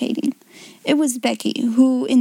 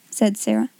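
plain reading voice

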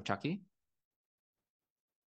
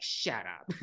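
flat deadpan snap; 5 semitones lower, 8 dB quieter than usual. Retold or imitated. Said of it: "immediately reverts back".

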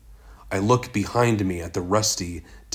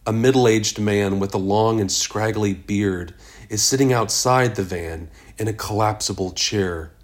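ordinary delivery

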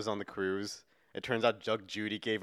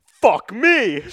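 loud, high movie-hero outburst; mock exasperated shout; 10 semitones higher, 13 dB louder than usual. Keanu under the bus finding the bomb, mock shout. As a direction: blurt it out in comic alarm. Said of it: "he's like".

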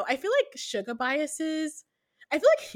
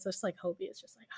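swooning breathy whisper ending in a sigh; 5 semitones lower, 13 dB quieter, faster than usual. Real, not acted. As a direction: whisper it like you're lovestruck. Swooning over favourite idol.

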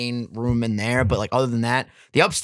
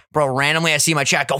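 ordinary talk, nothing marked

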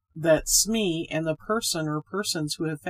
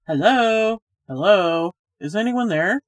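louder calling out into the dark; shouting; 3 semitones higher, 8 dB louder than usual. A narrator's reading. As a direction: call out to find the hidden pair. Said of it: "young Reggie's voice shouting".

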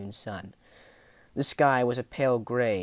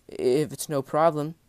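high, anxious, louder sailor voice; timid hedging; 5 semitones higher, 6 dB louder than usual. Acted. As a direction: tack it on nervously, pitched up. Frightened sailor hedges his offer.

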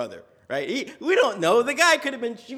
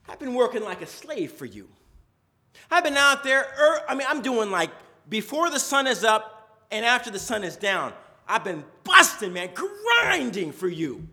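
louder, indignant complaint; aggrieved older son; 4 semitones higher, 8 dB louder than usual. Retold or imitated. Older brother complaining to his father, louder and aggrieved. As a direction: vent years of unthanked labour. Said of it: "He's like".

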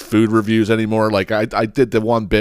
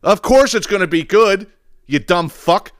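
ordinary talk, nothing marked

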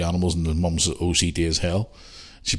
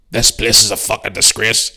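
slow, loud, outraged complaint; dad blowing his lid; 4 semitones higher, 7 dB louder than usual. Retold or imitated. Dad ringing his son about the hotel, blowing his lid. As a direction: imitate a furious dad, each word drawn out. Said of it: "Dad's fucking blowing his lid".

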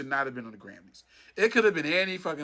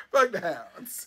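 lightly voiced villainous boss; half-hearted cackling villain line; Mister Burns; much slower than usual. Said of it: "Mister Burns".

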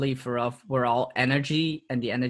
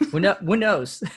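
laughing; 6 semitones higher, 4 dB louder than usual. Real, not acted. Laughing at slug karma joke.

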